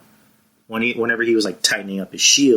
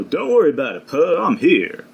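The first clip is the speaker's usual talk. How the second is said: smooth, higher Lando voice; Lando impression; 6 semitones higher than usual. Doing Lando for his co-host, a smooth impression. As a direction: play the suave rescuer Lando. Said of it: "that was my Lando, uh, impression".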